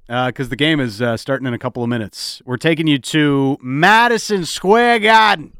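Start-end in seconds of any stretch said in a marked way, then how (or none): 2.4-5.5 big, slow broadcast hand-off; radio announcer. Acted. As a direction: announce it slowly and louder, on air.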